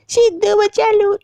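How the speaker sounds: very high, slow mock cry for help; fake damsel plea. Girlfriend faking distress in a video, high slow mock plea. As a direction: ham up a helpless girl begging. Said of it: "नाटक कर रही होती".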